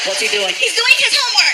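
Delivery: high-pitched mock woman's voice; high, indignant phone voice; exasperated girlfriend. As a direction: answer as an upset girlfriend.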